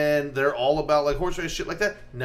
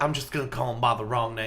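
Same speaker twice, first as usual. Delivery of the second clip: Bush impression, Southern; 3 semitones lower, 4 dB quieter than usual. Podcaster attempts Bush choosing the wrong name, camp Southern accent.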